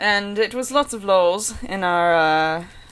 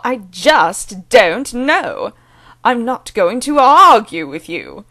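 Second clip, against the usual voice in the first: loud, higher, slow, swooping posh British glide; mock Doctor Stern; 5 semitones higher, 9 dB louder, slower than usual. Vlogger imitating the accent tape sentences, exaggerated British glide. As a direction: ham up the teacher's pitch glides. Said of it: "repeat the way that he says these sentences".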